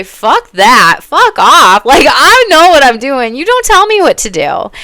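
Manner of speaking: loud, high, defiant yell; shouting back, fed up with being bossed; defiant outburst. Retold or imitated. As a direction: tell them off at full volume. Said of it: "speak the fuck up and say".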